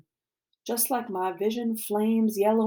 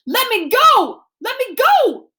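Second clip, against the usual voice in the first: high, loud struggling yell; frantic screaming; 13 semitones higher, 10 dB louder than usual. A narrator's reading. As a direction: scream to be released. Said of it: "shouting, screaming".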